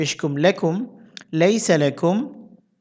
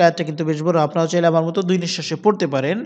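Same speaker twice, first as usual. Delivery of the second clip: ordinary delivery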